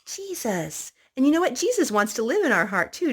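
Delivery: plain reading voice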